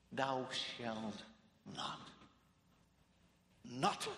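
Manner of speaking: "not" set apart by a pause, then repeated; stress on NOT. Shown as a demonstration. Preacher showing his emphasis, "not" repeated alone. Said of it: "Did you hear my emphasis?".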